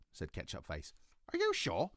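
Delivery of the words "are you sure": put-on high, curious little girl. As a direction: ask it like a small girl. Ketchup Face voiced in story.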